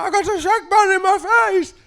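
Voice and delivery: high, loud pleading cry; desperate repeat. Retold or imitated. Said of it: "I was like".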